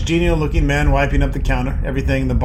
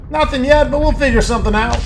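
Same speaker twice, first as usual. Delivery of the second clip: loud, high-pitched foundry worker; raised, forceful voice of a half-deafened worker; shouting; 9 semitones higher, 5 dB louder than usual. A narrator's reading. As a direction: keep shouting over the noise of the works. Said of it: "He shouts".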